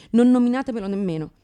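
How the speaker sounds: ordinary talk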